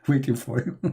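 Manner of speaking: ordinary talk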